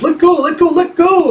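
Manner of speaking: mock-frantic squeaky begging; squeaky pleading. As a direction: beg to be let go in a squeak. Joe pinned, begging release.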